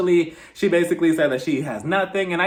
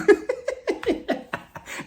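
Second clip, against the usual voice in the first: high airy laugh. Real, not acted. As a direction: laugh with barely any voice. Laughing at the show.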